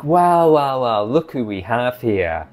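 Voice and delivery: a mock villain greeting; hammed-up thug taunting the heroes; gang thug taunt. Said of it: "gang of unruly people are like".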